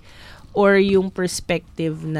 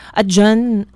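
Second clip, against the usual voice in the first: normal talking voice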